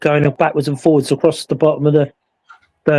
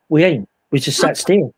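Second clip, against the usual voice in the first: usual speaking voice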